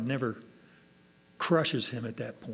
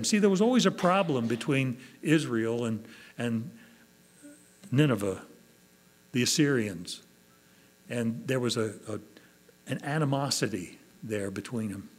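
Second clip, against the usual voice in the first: normal talking voice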